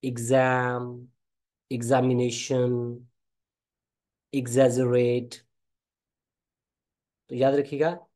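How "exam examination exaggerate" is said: slow word list, "एग्जाम, एग्जामिनेशन, एग्जैजरेट" each opening IG; IG pronunciation. Shown as a demonstration. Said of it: "इग की आती है". ("एग्जाम, एग्जामिनेशन, एग्जैजरेट" धीरे, शुरू में इग)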